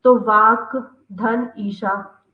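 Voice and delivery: वाक धन ईशा said slow and apart; split slowly. Shown as a demonstration. Teacher splitting वागीशा into parts, slow and deliberate. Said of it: "अपन संधि विच्छेद करेंगे".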